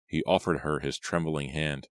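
ordinary delivery

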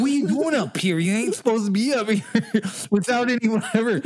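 high-pitched bad impression breaking into laughs; hammed-up giant, high and laughing; Luis Guzmán. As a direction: challenge an intruder in a badly faked actor voice. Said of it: "I can't do a very good voice of his".

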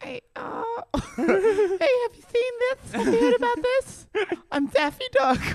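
squeaky high Jay Leno meets Daffy Duck, badly done. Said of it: "That's neither voice".

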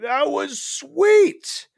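high, slow, spaced-out drawl; dazed fan voice. Retold or imitated. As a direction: stretch it out like a blissed-out fan. Mimicking dazed fans after show.

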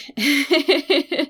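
high giggle; amused, high-pitched laugh; real giggle. Real, not acted. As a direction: laugh lightly at yourself. Laughs at her long list.

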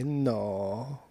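a hint of a chatty grumbling charwoman; lightly voiced grumbling cleaning lady; Mexican cleaning lady. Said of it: "You sound like the Mexican cleaning lady from Family Guy".

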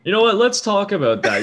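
sudden high, loud burst; excited. Real, not acted.

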